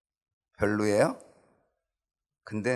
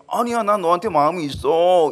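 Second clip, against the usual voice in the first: Samson protesting; 8 semitones higher, 9 dB louder than usual. Acted. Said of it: "이렇게 가는 거잖아요".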